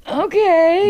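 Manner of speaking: high, tearful okay after a sniff; real tears catching in the throat; a small okay as emotion breaks through; choked-up okay. Real, not acted. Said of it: "I'm, like, choking up a little bit".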